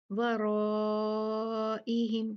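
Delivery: very slow वराइहिम with the raa held long on one level note; four-count madd. Shown as a demonstration. Teacher demonstrating obligatory madd, long-held aa in वराइहिम. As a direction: stretch the aa in वराइहिम before the glottal break. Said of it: "चार count खींचेंगे इसको".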